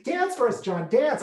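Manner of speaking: high, eager dance for us; imitating the parents' request; teasing parents. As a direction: cheer him on playfully. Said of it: "And then they said".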